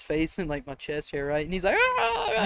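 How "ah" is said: imitated scream. Storyteller imitating a boy grabbed in initiation, high thin mock scream. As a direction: squeal as if being smothered, in play. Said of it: "He's screaming".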